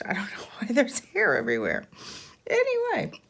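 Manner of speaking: usual speaking voice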